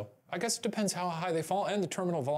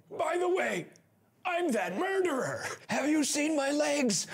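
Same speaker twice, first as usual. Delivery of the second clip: dying man voice; 12 semitones higher than usual. Retold or imitated. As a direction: ham up a dying confession. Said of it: "with their dying words be like".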